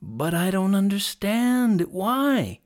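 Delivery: high, drawn-out plea; pleading. A narrator's reading. Hunter begs lion for explanation, pleading high. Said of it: "pleaded Sen".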